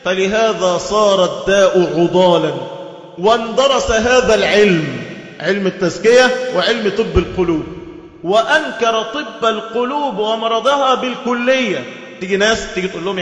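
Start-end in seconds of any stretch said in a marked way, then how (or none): 0.0-5.0 slow, measured classical recitation; slow scholarly quotation; solemn text reading. Acted. Quoting a classical text slowly. 8.2-11.8 slow, measured classical recitation; slow scholarly quotation; solemn text reading. Acted. Quoting a classical text slowly.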